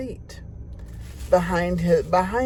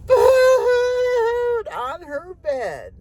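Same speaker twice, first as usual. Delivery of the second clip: much higher and slow, a hammed-up sob; mock bawling; 11 semitones higher, 3 dB louder, much slower than usual. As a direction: wail the words like a crying little girl. Acting out Cindy's crying.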